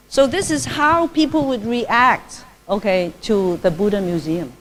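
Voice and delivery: normal talking voice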